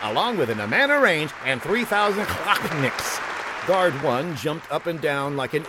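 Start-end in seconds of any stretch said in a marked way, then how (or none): 0.0-3.2 game show host. Acted. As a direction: announce the prizes like a host.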